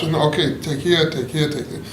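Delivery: ordinary delivery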